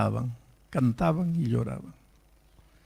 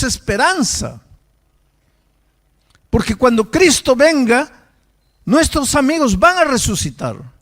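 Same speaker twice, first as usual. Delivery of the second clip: fervent hope; 7 semitones higher, 6 dB louder than usual. Retold or imitated. Girl answers grieving father.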